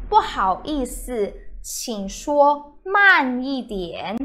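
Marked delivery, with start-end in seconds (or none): 1.6-4.2 slow. Shown as a demonstration. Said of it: "please speak slowly".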